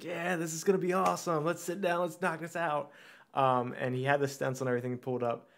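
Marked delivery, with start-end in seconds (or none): 0.0-2.9 higher-pitched eager tattoo artist; retold enthusiasm, raring to get started; super excited. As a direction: imitate an artist pumped for the job. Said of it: "my tattoo artist was super excited for it".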